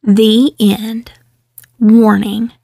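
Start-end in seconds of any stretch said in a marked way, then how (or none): none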